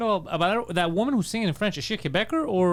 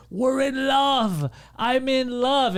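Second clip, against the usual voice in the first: higher, slow, swooning mock lover; mock lovestruck lady; 8 semitones higher, much slower than usual. Teller mocking the old woman who claims love, swooning. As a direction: drawl it out like a smitten old woman. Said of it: "the lady's like".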